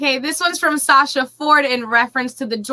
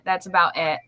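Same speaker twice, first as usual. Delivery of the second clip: British accent; 6 semitones lower, 6 dB quieter than usual. Shown as a demonstration. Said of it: "That was pretty good".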